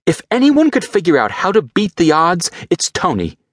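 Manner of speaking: high, fervent belief in Tony; Pepper, insistent. Acted. Pepper insists to Rhodey that Tony survives, high and fervent. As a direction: insist Tony would beat the odds.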